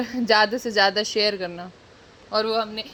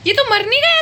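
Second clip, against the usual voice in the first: loud, high-pitched astonished question; shocked disbelief; 10 dB louder, faster than usual. Real, not acted. Reacting to a character seen in the movie, shocked disbelief. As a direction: blurt it out in high, loud surprise.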